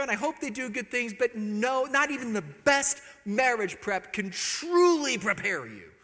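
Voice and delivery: normal talking voice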